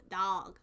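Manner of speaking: "dog" with a stretched ah; put-on northern "dog", said dahg; northern pronunciation. Shown as a demonstration. Showing what northerners say, "dog" as dahg. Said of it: "They say dog".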